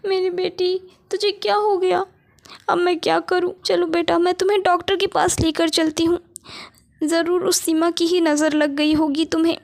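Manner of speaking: a mother frightened for her sick daughter; panicked mother. Acted. (बीमार बेटी के लिए घबराई माँ, ऊँची आवाज़)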